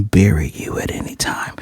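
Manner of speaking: whispered mock menace; quiet, breath-only warning; hushed threat. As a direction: drop into a whisper to deliver the retold threat. Retold threat to the buried bully.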